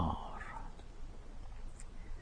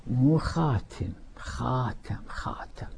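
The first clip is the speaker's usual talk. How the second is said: خاتم repeated softer each time, last one whispered; fading repetition; 8 dB quieter, slower than usual. Retold or imitated. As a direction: let خاتم die away to a whisper. Mocking word, fading.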